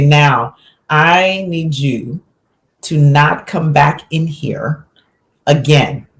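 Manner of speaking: slow, deliberate ultimatum with hard pauses; firm parental order. Retold or imitated. Mother telling her interrupting son to stay out, slow and firm. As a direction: lay down the law, word by word.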